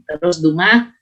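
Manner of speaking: normal talking voice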